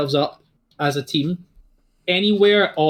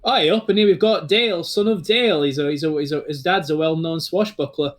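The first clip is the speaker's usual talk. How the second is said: Northern English comic voice; 3 semitones higher than usual. Acted. Commentator Larry joking to the arriving students, Northern English voice. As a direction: crack wise like a Northern English announcer. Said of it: "He goes".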